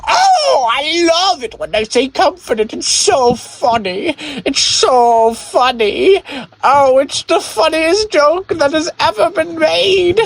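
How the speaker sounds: very high, drawn-out ecstatic squeal; Jeremy overexcited. Acted. Podcaster mimics a listener hearing the joke again, hammed-up ecstasy. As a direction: go absurdly overboard with shrill, stretched-out delight. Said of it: "and Jeremy was like".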